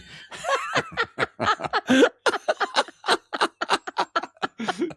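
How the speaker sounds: long high laughter. Real, not acted. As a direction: really laugh. Shameless plug joke.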